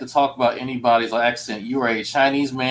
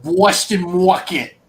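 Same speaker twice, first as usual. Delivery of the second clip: drawn-out, higher mock Boston accent; 5 semitones higher, 3 dB louder, slower than usual. Imitating Boston mother-in-law, slow hammed-up Boston accent. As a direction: exaggerate the Boston R-less "market", slowly. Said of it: "unless she says certain things".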